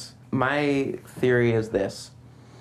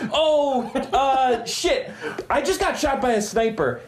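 panicked; 10 semitones higher, slower than usual. Retold or imitated. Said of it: "He's like".

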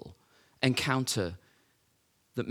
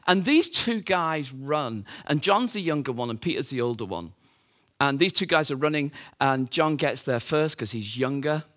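ordinary delivery

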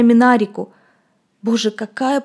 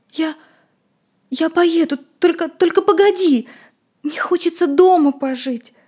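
much higher, pleading stammer; tearful stammering; 7 semitones higher than usual. Acted. Boy stammering, close to tears.

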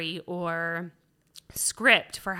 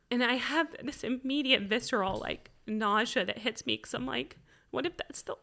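raised, choked-up voice; tearful; 4 semitones higher than usual. Real, not acted. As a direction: struggle through it while crying. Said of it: "I'm gonna start crying".

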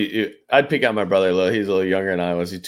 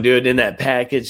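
ordinary talk

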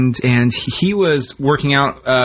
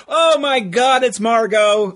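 starstruck; 8 semitones higher, slower than usual. Retold or imitated. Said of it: "we're like".

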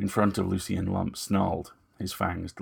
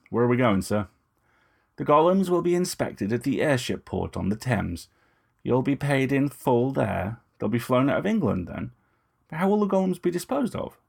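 lightly voiced young woman, a touch higher, cool and composed; Lucy's measured, slightly raised voice; Lucy, poised young woman; 5 semitones higher, 4 dB louder than usual. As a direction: speak as Lucy, politely defiant. Lucy questions Moust.

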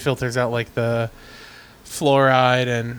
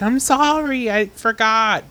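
mimicking the wife; 9 semitones higher, slower than usual. Wife's apology voiced higher.

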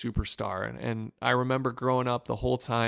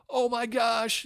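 much higher, choked-up big brother; crying brother; 12 semitones higher, slower than usual. Retold or imitated. Brother answering the phone already crying, much higher and choked up. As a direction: pitch it way up like a brother near tears. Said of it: "he's crying already".

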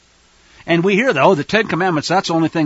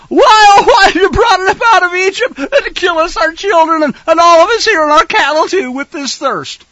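loud, much higher, nasal-free theatrical whine; whining crowd; 12 semitones higher, 8 dB louder than usual. Acted. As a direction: complain like a thirsty mob pleading. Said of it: "They whined. They murmured against Moshe".

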